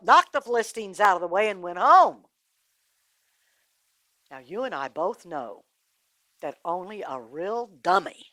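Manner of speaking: usual speaking voice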